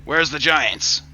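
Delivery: a baffled dwarf looking round for giants; lightly voiced dwarf, awkward and confused. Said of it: "is like".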